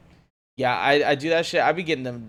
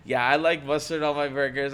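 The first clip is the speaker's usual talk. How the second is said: normal talking voice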